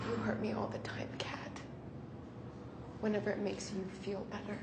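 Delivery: low, soft, wounded murmur; hushed hurt. Acted. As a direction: answer quietly, hurt and low. Hurt reply after the apology.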